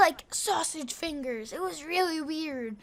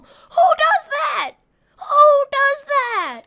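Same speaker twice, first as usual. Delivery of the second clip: high, loud, slow outraged cry; outraged shout; 11 semitones higher, 12 dB louder, much slower than usual. Real, not acted. Telling viewers about a kid threatening his mom, outraged high shout. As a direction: yell it in disbelief, stretching each word.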